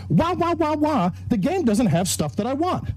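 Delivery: mock crying, high whine; whiny crybaby. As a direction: imitate a whining child. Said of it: "cry like an entitled little child".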